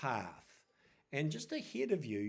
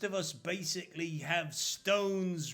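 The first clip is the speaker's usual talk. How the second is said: high, mocking sing-song on bread; mocking ringleader; 3 semitones higher, slower than usual. Ringleader mocks the priests' bread in a high sneer. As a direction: sneer at the bread like a hungry yob. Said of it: "and he goes".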